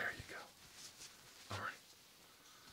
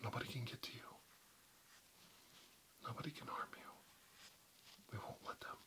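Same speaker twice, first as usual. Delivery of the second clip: softer and softer whisper; fading whisper; 3 semitones higher, 11 dB quieter than usual. Acted. Rescuer promising safety, whisper fading away. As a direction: let the promise fade into a breath.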